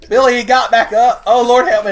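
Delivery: high, loud frantic yell; panicked shout. Real, not acted. Panicking at game zombie.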